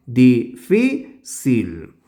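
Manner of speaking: Difícil drawn out slowly, leaning on the syllable fí; stressed syllable shown. Shown as a demonstration. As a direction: spell out Difícil slowly and hit fí. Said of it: "Por lo tanto". (Difícil muy lento, recalcando la sílaba fí)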